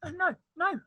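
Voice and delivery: ordinary delivery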